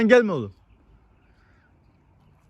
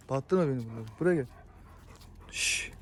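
ordinary delivery

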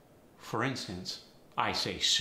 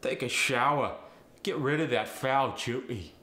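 loud cockney telling off; cockney accent; 2 semitones higher, 5 dB louder than usual. Shown as a demonstration. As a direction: snap at them in cockney. Example sentence in Cockney slang.

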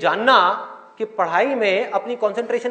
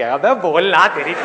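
mock exasperated heckle, loud at the start; agitated outburst; 3 semitones lower, 5 dB louder, slower than usual. Maddened audience getting up to confront the speaker, agitated outburst. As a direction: burst out like a fed-up listener. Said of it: "लोग बौखला जाएंगे".

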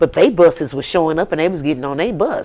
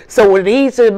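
ordinary delivery

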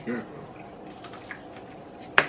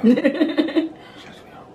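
laughing; 4 dB louder, much slower than usual. Real, not acted.

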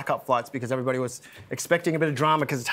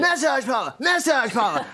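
put-on high, insistent, over-broad Australian accent; exaggerated Aussie accent; 8 semitones higher than usual. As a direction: repeat it in an ever broader Aussie twang. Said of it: "louder and louder in more of an Aussie accent".

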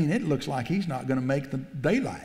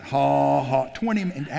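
ordinary talk, nothing marked

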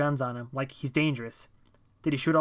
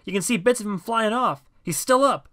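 very high, loud, frantic play-by-play; excited shouting; 8 semitones higher, 6 dB louder than usual. A narrator's reading. John reporting the attack from the statue, frantic shouting. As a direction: yell each new horror as it happens. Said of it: "shouted John".

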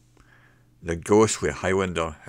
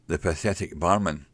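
ordinary delivery, nothing marked

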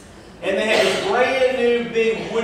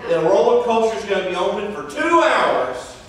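dramatic announcement. Retold or imitated. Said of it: "chipper sixteen-year-old guy says".

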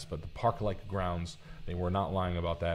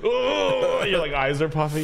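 usual speaking voice

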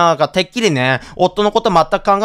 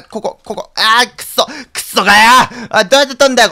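frustrated; 5 semitones higher, 4 dB louder than usual. Real, not acted. Fails to grab item repeatedly.

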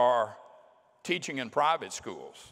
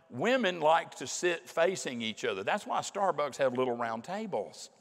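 ordinary delivery

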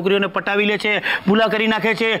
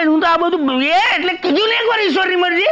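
high, flustered protest; high-pitched cornered excuse; exasperated defence; 7 semitones higher than usual. Acted. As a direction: plead helplessness in a raised pitch.

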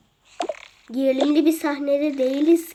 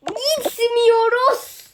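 stubborn whine; 7 semitones higher, much slower than usual. Acted. Refusing to go, stretched whine.

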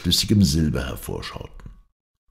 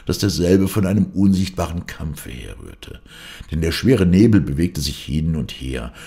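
usual speaking voice

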